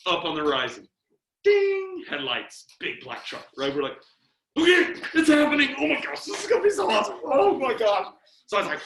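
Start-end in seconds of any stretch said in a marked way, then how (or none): 1.4-2.0 high, loud ringing ding; imitated ding. Imitates headlights appearing. 4.6-8.1 high, loud thrilled outburst; acted giddy excitement. Boys see the truck coming.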